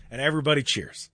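ordinary talk

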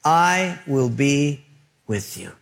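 measured divine promise, each word given room; God. Acted. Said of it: "And God said".

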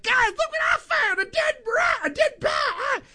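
very high, shouted mock announcement; proud mock shout. Host mimics coworker proudly announcing dead bat to boss, very high mock shout. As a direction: trumpet the find like a lottery win. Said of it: "she was so proud".

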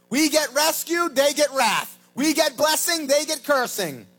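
loud, high, slowed preaching cry; preaching shout. Real, not acted. Preacher shouting to his congregation, loud and high. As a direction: thunder out the contrast. Preacher contrasting saved and lost.

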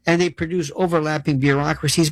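ordinary talk

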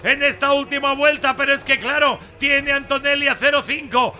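live final-lap play-by-play; motorsport commentator. Acted. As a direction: call the last lap, slowed, building tension.

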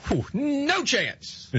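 high, drawn-out scoffing whistle; mock incredulity. Radio host scoffing at a pundit's football pick, mock disbelief. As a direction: savor how wrong the pick was, stretched slow. Mocking a pundit's bad prediction.

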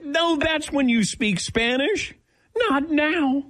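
very high-pitched retold alarm; mock panic. Patient begging doctor to speak Spanish about the needle, high-pitched mock panic. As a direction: plead it way up high, comically alarmed.

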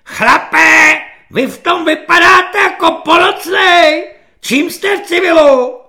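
high, loud barking drill corporal; screeching corporal. Acted. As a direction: yell it at the recruit like a shrill petty corporal. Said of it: "Povzbuzován ječivým hlasem svobodníka".

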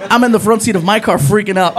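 ordinary delivery

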